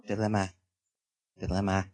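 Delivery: low, quiet dramatic quoting; a hint of the cartoon mouth; SpongeBob quote. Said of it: "Reference to SpongeBob there".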